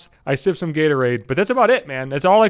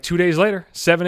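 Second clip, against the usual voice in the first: normal talking voice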